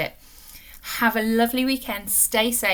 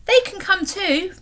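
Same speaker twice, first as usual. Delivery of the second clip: put-on higher, bright little girl's voice; a child insisting everyone come; eager girl; 9 semitones higher, 5 dB louder than usual. A narrator's reading. Polly speaks as excited girl.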